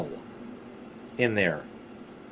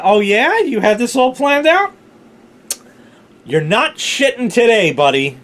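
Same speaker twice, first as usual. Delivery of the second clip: louder and higher, mocking taunt; taunting body voice; 9 semitones higher, 6 dB louder than usual. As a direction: tease yourself like a smug bully. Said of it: "My body said".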